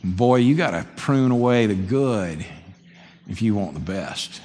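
slow, low South Alabama drawl, grandpa's wisdom; remembered Southern grandfather's saying, slowed down. Retold or imitated. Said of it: "And I'll never forget that".

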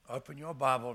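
usual speaking voice

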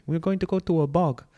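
high-pitched mock friend voice; put-on high voice; friend's answer. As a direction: imitate a friend announcing the plan up high. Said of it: "my friend always says that".

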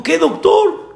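high-pitched acted desperation; imitated tearful plea. Distressed father questioning the doctor, imitated crying. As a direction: ask it high, mimicking someone in tears.

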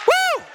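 very high, loud whoop of joy; preacher's ecstatic high woo; joyful woo shout. Real, not acted. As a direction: let out a shrieking woo.

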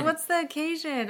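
high-pitched, eager question as the husband; excited imitation. Wife imitates husband asking about the biscuits, excited. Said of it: "So he's all excited".

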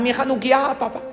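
high-pitched yell for papá; acted wail of alarm, pitched well up; child's cry out. Boy who broke his menorah crying to his father, alarmed yell. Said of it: "Empieza a gritar".

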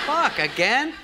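fuck, again, yelped high and loud through a laugh; exasperated disbelief. Retold or imitated. Fed up when Lorne's office asks who he is.

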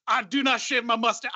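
lightly put-on French accent; a hint of a French actor refusing to shave; mock French accent. Co-host mimics Pedro Pascal refusing to shave, light French accent. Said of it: "I don't know why I'm making him French".

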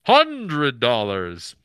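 very loud, slow booming god voice. Retold or imitated. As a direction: boom out the price from on high. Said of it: "he has a booming god voice".

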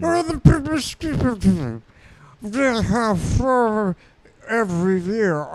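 high, slow garbled British blustering; Nigel Thornberry. Acted. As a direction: slur the words as a cartoon Brit. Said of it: "British Thornberry accent".

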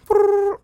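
high rolled brrr; playful high phone-ring noise; imitated phone trill. Imitates a phone dialling or ringing.

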